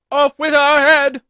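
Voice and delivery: a queen screaming a threat; furious shout. A narrator's reading. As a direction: roar the warning at the Duchess. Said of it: "and shouting".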